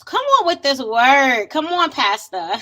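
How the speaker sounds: loud, high playful church cheer; mock-churchy hype shout; congregation call-out. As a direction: holler encouragement like someone in the pews.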